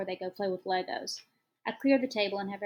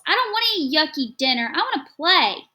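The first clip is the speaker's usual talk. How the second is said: put-on child tantrum; 8 semitones higher, 12 dB louder than usual. A narrator's reading.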